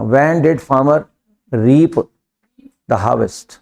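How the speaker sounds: slow, teacher dictating; slow dictation. Shown as a demonstration. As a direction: lay the sentence out word by word. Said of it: "farmer".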